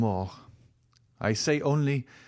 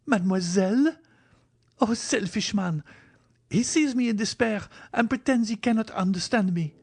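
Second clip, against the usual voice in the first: much higher, louder, stinging reproach; "Mademoiselle?" cried high and louder in outrage; wounded cry; 12 semitones higher, 3 dB louder than usual. Acted. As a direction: hurl it back at him, hurt and angry. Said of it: "cried Valentine".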